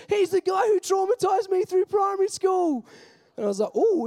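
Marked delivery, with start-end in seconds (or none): 0.1-2.9 much higher, louder tipsy shout; drunk excited shout. Retold or imitated. Tipsy guest shouting to girlfriend, much higher and loud. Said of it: "he goes". 3.8-4.1 mock wince. Caught out at wedding.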